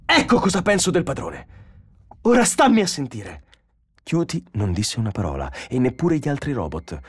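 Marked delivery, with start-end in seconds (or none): 0.0-3.5 high, raised, heated voice; loud, contemptuous outburst; angry defiance. Acted. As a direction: throw it down as a challenge. Donovan spits, rages at the robot.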